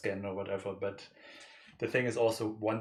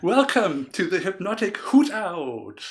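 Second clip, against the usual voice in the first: big, slow show-host welcome; announcer intro; 8 semitones higher, 10 dB louder, slower than usual. Acted. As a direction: announce the show title grandly.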